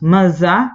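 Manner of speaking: "Manzá" with the final á sent through the nose; very nasal vowel. Shown as a demonstration. Teacher giving a word before translating it, nasal "Manzá". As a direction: say "Manzá" as if stuffed up with a cold. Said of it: "Totalmente resfriados".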